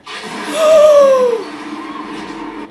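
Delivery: high, loud shocked gasp. Real, not acted. Viewer gasps at a shocking moment, loud.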